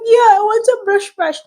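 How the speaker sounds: high childlike mock toddler voice. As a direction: play the little daughter eagerly wanting the brush. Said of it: "She'll be like".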